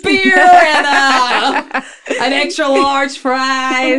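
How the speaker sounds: drawn-out, high, loud American order; hammed-up American accent, stretching the words; mock American accent. Joking American customer ordering fries, mock accent. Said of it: "Why did you go American there?".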